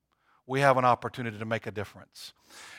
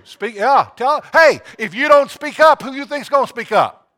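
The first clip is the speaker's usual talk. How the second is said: much louder and higher, calling the church to speak up; rousing preacher shout; 10 semitones higher, 10 dB louder than usual. Real, not acted. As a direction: preach it out at full force.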